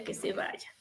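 whispered and unvoiced, fading away; a last whispered echo of que se vaya. Shown as a demonstration. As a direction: let it die out in a bare whisper. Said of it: "que se vaya, que se vaya".

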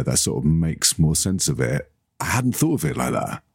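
ordinary talk

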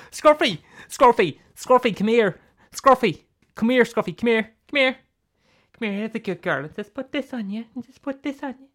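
high-pitched calling to a pet; coaxing dog owner. Acted. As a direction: call the dog over, then soften into coaxing.

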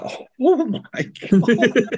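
high, startled "oh my God", half-mock; shocked gasp. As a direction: replay the shock of watching him hit the ground. Retelling seeing a runner fall.

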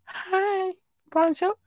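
tiny, very high squeal; high-pitched cutesy greeting. Pretend. Podcaster greeting listeners in France, high cutesy squeal. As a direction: coo hello far up high.

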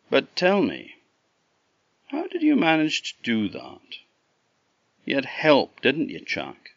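lightly voiced, needling interrogator; suspicious Green Man. Green Man asks Chuck how he managed it, suspicious.

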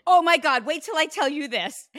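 high, loud excited outburst; dramatic mom. Retold or imitated. Medium imitates mom about to share news, dramatic. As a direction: gush like you're bursting with news. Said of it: "She's very dramatic".

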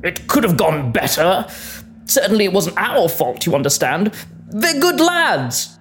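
a hint of a gruff veteran; gruff army man voice.

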